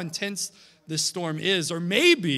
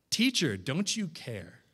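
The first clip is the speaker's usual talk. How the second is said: softer, lower plea; quiet reflective echo; 4 semitones lower, 5 dB quieter than usual. Shown as a demonstration. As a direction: let the question sink in gently. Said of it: "How did the disciples respond?".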